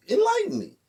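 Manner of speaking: high mocking enlighten me; sarcastic. Teller mocks his cellmate's advice with a high sarcastic jab. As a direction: throw it back with sarcasm.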